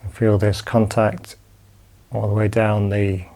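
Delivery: calm, unhurried body-scan guidance with long pauses; guided meditation. Acted. Teacher guiding students lying down through a body scan, calm meditation voice.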